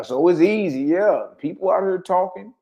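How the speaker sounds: ordinary talk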